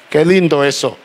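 normal talking voice

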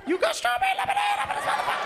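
very high, fast falsetto shout; mock excited squeal. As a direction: shriek it like a thrilled customer. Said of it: "Their voice goes up, like, twelve octaves".

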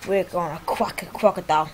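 hammed-up Aussie croc-hunter voice; a light mock Australian accent, rolling crocodile; Stephen Irwin impression. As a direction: play the excited Australian wildlife host. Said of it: "this is what he really sounds like".

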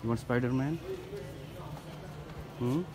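usual speaking voice

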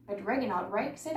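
ordinary delivery

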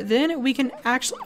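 ordinary talk, nothing marked

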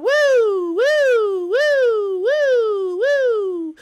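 loud, high, falling woos; gleeful sing-song whoops; repeated whooping. Pretend. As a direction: whoop over and over, evenly. Cheering while having a good time.

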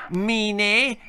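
sulky brat. Acted. Percy sulks at mother.

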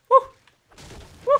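very high, loud woo cheer; celebrating solo wins, shrieking high and loud. Real, not acted. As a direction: whoop it out in triumph.

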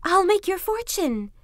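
high-pitched fox, persuasive; bargaining fox. A narrator's reading. Trapped fox bargaining with Kuzma, high and persuasive. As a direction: pitch it high and coax the captor.

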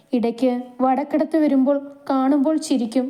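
ordinary delivery

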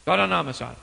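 calm and low, reassuring; gentle consoling. Acted. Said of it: "Olhou pra mulher e disse assim".

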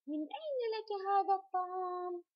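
high-pitched question, drawing out الطعام. Shown as a demonstration. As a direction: ask "من أين لك هذا الطعام" on a high, held pitch to the end. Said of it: "إذن أنا بسأل". (نبرة استفهام عالية مع مد الطعام)

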